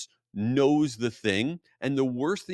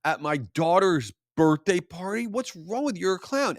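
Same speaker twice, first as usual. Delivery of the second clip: higher, incredulous telling-off; exasperated scolding; 6 semitones higher than usual. Retold or imitated. Dad scolding the hungover Dora performer, incredulous and higher. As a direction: scold him, half laughing in disbelief. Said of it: "I'm like".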